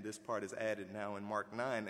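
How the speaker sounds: ordinary talk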